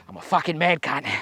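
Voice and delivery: quiet self-pump before the shot; self-hype. Real, not acted. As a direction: psych yourself up under your breath. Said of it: "and I told you I am".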